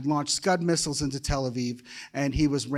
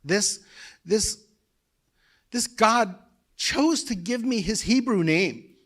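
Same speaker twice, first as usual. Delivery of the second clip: higher, halting, overcome with real emotion; emotional; 6 semitones higher, 3 dB louder, slower than usual. Real, not acted. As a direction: push the words out through rising emotion. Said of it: "Sorry, I'm pretty emotional".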